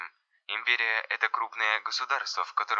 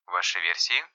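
usual speaking voice

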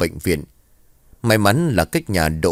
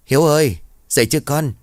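higher, louder call through the door; calling again louder; 7 semitones higher, faster than usual. Acted. Mother knocks again and calls her silent son, louder. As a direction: call him again, pushing the voice to carry. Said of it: "gõ cửa rồi hỏi tiếp".

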